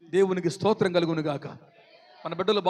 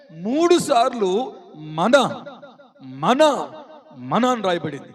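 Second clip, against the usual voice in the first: మన repeated as a punched, separate word; slow build-up, hitting మన hard; stress on మన; 5 dB louder, much slower than usual. Shown as a demonstration. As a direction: set each మన apart with pauses. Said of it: "మీరు బాగా గ్రహించాలి వాక్యాన్ని".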